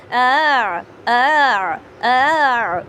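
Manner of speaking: آ sung out loud and long, showing swallowing and releasing; tasheel demo. Shown as a demonstration. Teacher demonstrating the swallowed hamza to students, loud and drawn out. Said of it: "اس طریقے سے ہوگا کچھ".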